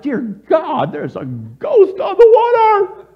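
high, loud cry of alarm; hammed-up fright at a ghost; terrified cry. Disciples spotting a figure on the sea, hammed-up terror. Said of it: "goes with all the scary stuff".

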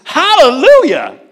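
loud, high hallelujah; joyful exclamation. Retold or imitated. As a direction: cry out praise in amazement. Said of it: "The other daughter says".

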